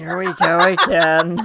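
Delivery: loud, drawn-out groaning delivery; exasperated call. Acted. Drac reacts to the chaos.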